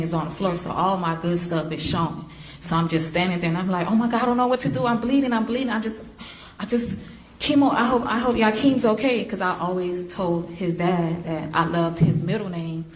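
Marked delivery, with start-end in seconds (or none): 3.9-9.2 frantic, voice pitched high with fear; panicked. Retold or imitated. Mother reliving her labour scare, high panicked voice. Retold panic while bleeding.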